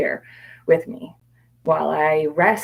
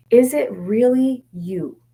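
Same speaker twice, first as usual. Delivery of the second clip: doubtful questioning; 7 semitones higher, 4 dB louder, slower than usual. Retold or imitated. Questioning the dark thought.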